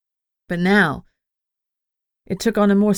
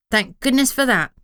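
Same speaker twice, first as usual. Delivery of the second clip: a bright, higher little voice piping in; small familiar piping up, pitched higher; piped up; 6 semitones higher than usual. A narrator's reading. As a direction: chirp it out, pitched up. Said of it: "Frogmatch piped up".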